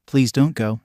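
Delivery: ordinary delivery, nothing marked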